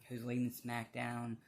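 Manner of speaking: ordinary talk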